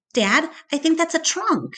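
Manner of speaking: high, eager and breathless with discovery; excited girl. A narrator's reading. Julia tells her dad she sees a trunk, excited. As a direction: realize it with delight.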